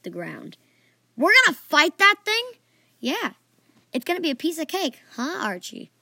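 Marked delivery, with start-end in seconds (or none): 1.2-2.5 loud, high incredulous question; shocked disbelief. A narrator's reading. Archie seeing the huge dragon, loudly shocked. 3.0-5.9 smug needling. A narrator's reading. Jihiri throwing Archie's words back at him, smug. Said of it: "Jihiri said smugly".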